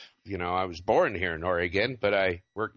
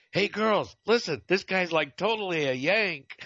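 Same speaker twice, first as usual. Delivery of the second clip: high-pitched mimic of a girl calling to her coworkers; imitated girl's yell; 10 semitones higher than usual. Fast-food worker alerting the other girls to an American customer, imitated high-pitched call. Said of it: "I heard the gal yell".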